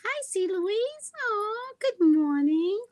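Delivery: very high, drawn-out cooing hello; squealed good morning, stretched at the end; high sing-song greeting. Real, not acted. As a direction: greet a friend in a high, sweet coo. Greeting a viewer by name.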